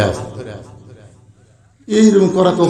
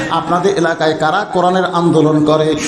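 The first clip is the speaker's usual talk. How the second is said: ordinary talk, nothing marked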